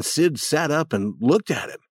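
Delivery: ordinary delivery, nothing marked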